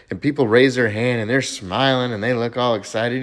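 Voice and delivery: usual speaking voice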